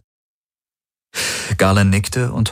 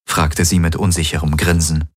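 plain reading voice

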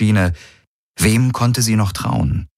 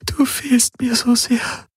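very high, slow, tearful lament; tearful grief; slower than usual. A narrator's reading. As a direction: draw it out as you mourn, close to weeping. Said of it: "Sie hatte sich geirrt".

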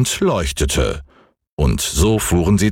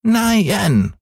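sehr hohe Kinderstimme, trotzig, nicht lauter; trotziger Häwelmann; 12 semitones higher than usual. A narrator's reading. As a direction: ein trotziger kleiner Junge ruft hoch. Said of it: "schrie Häwelmann". (sehr hohes, trotziges Kinder-Nein)

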